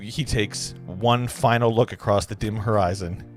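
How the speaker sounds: ordinary talk, nothing marked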